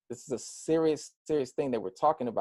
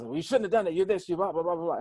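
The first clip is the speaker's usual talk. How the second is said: mock yell; 5 semitones higher, much faster than usual. Retelling how a police officer scolded him, mock yell. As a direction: imitate someone telling you off, ending in blah-blahs. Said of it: "he just yells".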